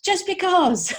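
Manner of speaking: high, loud fed-up retort; exasperated parent. Retold or imitated. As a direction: give up and snap back at the child. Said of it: "and eventually you go".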